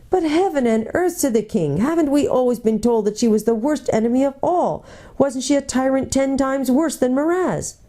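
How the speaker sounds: high, startled protest; shocked young king. A narrator's reading. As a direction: protest in disbelief.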